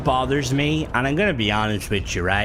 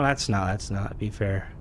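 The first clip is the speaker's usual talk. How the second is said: ordinary delivery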